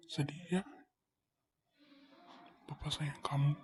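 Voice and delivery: soft whispered goodbye; tender whisper. Acted. Father whispering his love to his daughter, tender whisper. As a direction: breathe the words to her gently.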